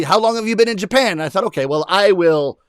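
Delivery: ordinary delivery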